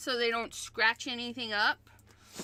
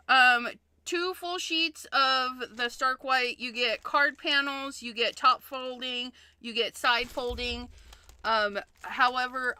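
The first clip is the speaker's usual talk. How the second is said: ordinary talk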